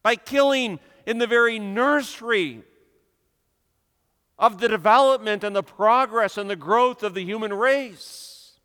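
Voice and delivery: loud, high, impassioned pulpit delivery; fiery preaching. Acted. Preacher condemning the age to his congregation, loud and impassioned. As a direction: thunder it from the pulpit against the evil.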